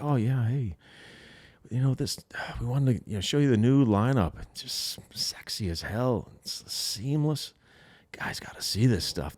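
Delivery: soft, breathy, sensual pitch; breathy sales voice. Retold or imitated. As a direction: breathe it seductively. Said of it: "like, breathy".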